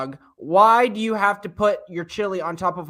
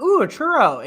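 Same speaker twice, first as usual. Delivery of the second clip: higher, louder eager "ooh"; mock excitement; 8 semitones higher, 7 dB louder than usual. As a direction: play someone spotting a tempting treat. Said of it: "you're gonna be like".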